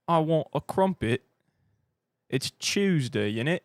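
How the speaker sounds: slow, hammed-up British accent; mock British. Streamer making fun of British speech tropes, mock British. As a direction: stretch it out as a caricature Brit. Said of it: "make fun of us for speaking like that".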